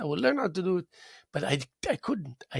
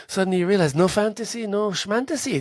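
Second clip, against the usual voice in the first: excited; 5 semitones higher, 5 dB louder than usual. Real, not acted. Excited dismissal of doubt.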